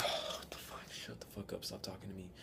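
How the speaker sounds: whispered exasperation under the breath; hushed mutter. Retold or imitated. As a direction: hiss it very quietly so nobody overhears. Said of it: "not gonna say it loud".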